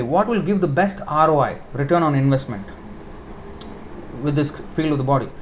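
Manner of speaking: usual speaking voice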